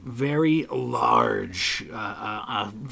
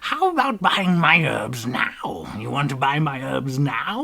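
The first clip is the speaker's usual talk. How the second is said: creepy hag voice; 2 semitones higher, 5 dB louder than usual. Acted. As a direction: croon it like a grinning monster hawking herbs. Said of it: "looks at you and says".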